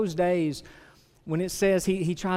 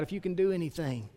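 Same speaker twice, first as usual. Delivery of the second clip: softly beg, sinking quieter; pleading father; 2 semitones lower, 9 dB quieter than usual. Acted. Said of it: "the man cries out".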